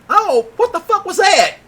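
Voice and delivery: high, loud frightened yell; panicked yell. Retold or imitated. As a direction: shout it like you're running scared. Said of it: "They said the N-word yet again".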